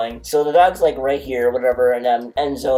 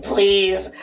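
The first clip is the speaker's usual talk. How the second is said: pleading; 7 semitones higher than usual. Retold or imitated.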